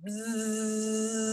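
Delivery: soft buzzing like a bee; quiet, long bee buzz. Shown as a demonstration. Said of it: "and buzz like a bee".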